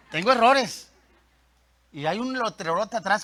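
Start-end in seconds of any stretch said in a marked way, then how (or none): 0.1-0.9 louder, emphatic confession; punched-up, open admission to the whole room. Real, not acted. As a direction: own up to it out loud. Repeats admission openly.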